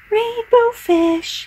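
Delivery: high, slow calling little fish. A narrator's reading. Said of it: "he called".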